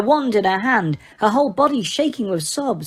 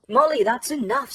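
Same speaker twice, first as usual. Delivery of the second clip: firmly; 5 semitones higher than usual. A narrator's reading. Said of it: "said Lupin firmly".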